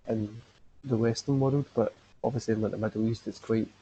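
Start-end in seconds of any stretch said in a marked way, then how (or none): none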